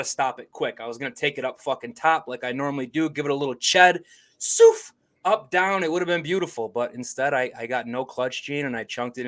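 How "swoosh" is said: sharp high imitated swoosh; whooshing ball sound. Golfer describing his flop shot, high imitated whoosh. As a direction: make the noise of the ball flying up.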